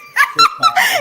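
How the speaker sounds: high, loud delighted laugh; sudden real laugh of surprise; burst of laughter. Real, not acted. Hearing the spirit box say TikTok, high loud laughter. Reacts to device saying TikTok.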